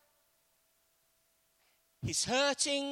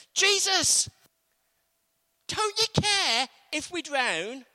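desperate prayer; 5 semitones higher, 6 dB louder than usual. Acted. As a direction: plead loudly, frightened and raw. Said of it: "That's the way they prayed".

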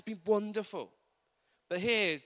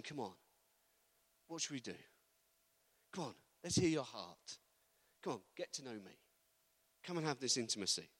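soft, lowered coaxing voice; tender, hushed encouragement with pauses; gentle invitation; 5 semitones lower, 8 dB quieter than usual. Acted. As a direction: invite them quietly to open up. God tenderly inviting into prayer.

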